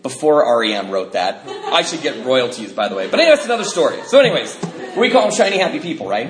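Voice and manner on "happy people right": normal talking voice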